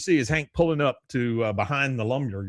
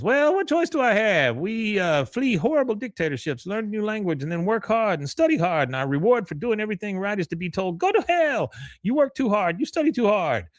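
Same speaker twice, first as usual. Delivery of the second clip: higher, ranting voice; mock Khan rant; 10 semitones higher than usual. Khan tells Hank his grievances, high and ranting. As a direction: vent it like a bitter immigrant dad. Imitating Khan's outburst.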